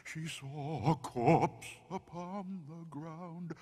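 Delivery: creepy campfire poem with drawn-out oohs; spooky poem recital. A narrator's reading. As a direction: recite a ghost rhyme to give chills.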